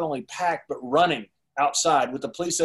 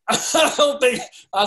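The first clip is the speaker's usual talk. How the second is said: high, loud words breaking into laughter; cracking up mid-story; laughing; 8 semitones higher, 6 dB louder, slower than usual. Real, not acted. Laughing at his own story.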